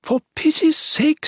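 high-pitched mild scolding; high-voiced reproach. A narrator's reading. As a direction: scold in a high, exasperated character voice. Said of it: "Erin scolded quietly".

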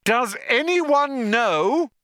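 slow, high, emphatic yell. A narrator's reading. Trillian repeating her question to the crew, yelling. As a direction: stretch each word out high. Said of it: "yelled Trillian".

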